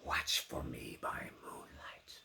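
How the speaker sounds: slow, hushed ghost of his promise; whispered echo. Acted. Bess remembering the doomed man's words, whispered slowly. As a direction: whisper it like a haunting memory.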